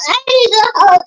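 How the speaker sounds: high comic callout; playful callout. Acted. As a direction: call it out like a hype man. Playful call-out.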